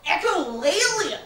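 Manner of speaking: "Echolalia" drawn out slowly, loud and high; excited exclaim. Shown as a demonstration.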